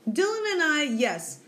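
usual speaking voice

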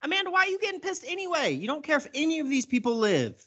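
excited rant. Real, not acted. Podcaster ranting at Amanda Waller in disbelief, high and fast. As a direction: fire the questions off in disbelief.

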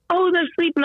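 a breezy high voice as if nothing is wrong; imitating the teacher. Teacher voiced in a retold scene.

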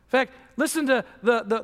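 ordinary delivery

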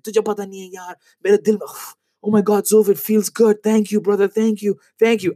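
high, gushing imitation of relief; relieved, grateful coworker. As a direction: pour out thanks in a high voice, as if unburdened. Acting Ilyas relieved, thanking after venting.

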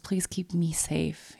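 whispered prayer. Retold or imitated. Retold prayer for herself.

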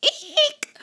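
high squeaky eek; mock fright squeal. Mouse frightened by a pumpkin, high squeal.

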